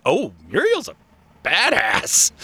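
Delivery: swooping high imagined reaction; playfully amazed; impressed exclamation. Retold or imitated. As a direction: exclaim it in mock awe, pitch swooping up. Imagined admiring reaction to character.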